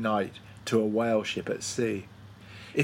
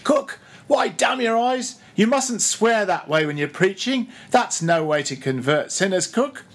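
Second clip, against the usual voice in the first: much higher, louder reproach; scolding; 8 semitones higher, 4 dB louder than usual. A narrator's reading. Stubb scolds the cook for swearing at the sharks, high and loud. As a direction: tell him off for swearing.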